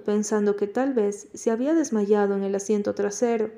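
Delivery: plain reading voice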